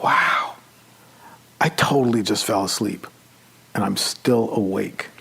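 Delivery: soft, almost whispered wonder; a quiet, breathy amazed aside; hushed inner thought. Retold or imitated. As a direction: murmur it like you might wake yourself. Thought while falling asleep.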